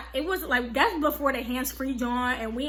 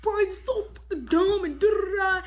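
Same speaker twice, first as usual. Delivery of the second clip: high-pitched mocking sister voice; exaggerated high whine of insult; Desiree; 7 semitones higher than usual. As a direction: mimic a mean sister's wailing scolding, pitched way up. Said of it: "Y'all know how Desiree is, mean as hell".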